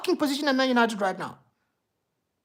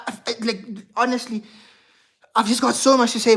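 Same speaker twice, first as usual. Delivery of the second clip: ordinary talk, nothing marked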